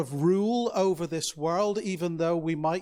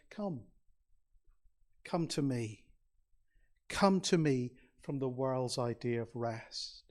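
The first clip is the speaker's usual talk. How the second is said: much softer, tender call: come, come to me; gentle invitation; 4 semitones lower, 5 dB quieter than usual. Retold or imitated. Jesus invites weary people to him, softly and tenderly. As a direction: draw the listener in with a hushed, coaxing invitation.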